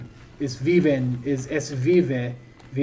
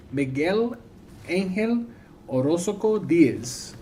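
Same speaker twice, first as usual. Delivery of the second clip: slow, put-on Latin American accent, name drawn out; exaggerated Latin American accent; 5 semitones higher, much slower than usual. Streamer greets a viewer by full name, mock Latin American accent. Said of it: "being offensive with my accent, my Latin American accent".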